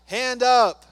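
loud high barked order; hammed-up shouted order; mock shout. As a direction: yell it as a mock command. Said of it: "Roy is like".

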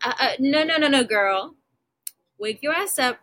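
put-on no no no girl, sassy and loud; sassy scolding. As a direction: scold her like a sassy best friend. Scolding a girl who settles.

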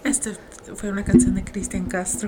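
soft, hushed DJ voice; smooth radio DJ. Retold or imitated. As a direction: croon it like a late-night DJ. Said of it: "And they're like".

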